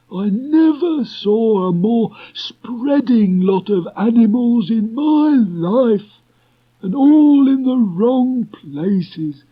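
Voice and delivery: very high, slow, put-upon donkey grumble; Eeyore, cross. Acted. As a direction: grumble at the crowd like a huffy old donkey. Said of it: "turned round angrily".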